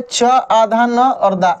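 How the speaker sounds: च, आधा न, दा spelled slowly; patient teacher's breakdown; slow spelling out. Shown as a demonstration. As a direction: spell चंदा out part by part. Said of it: "ऐसे भी लिख सकते थे".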